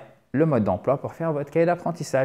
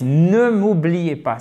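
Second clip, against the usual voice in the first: usual speaking voice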